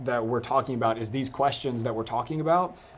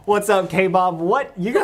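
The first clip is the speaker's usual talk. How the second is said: high, loud amused talk; laughing while teasing; 7 semitones higher, 8 dB louder than usual. Real, not acted. Host cracks up at nickname.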